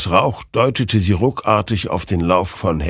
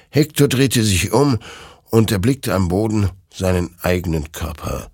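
ordinary talk

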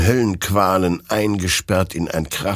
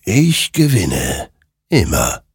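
slow, weighty spirit voice; ominous, drawn-out final claim; triumphant creature; slower than usual. A narrator's reading. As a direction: savour each word, with pauses between.

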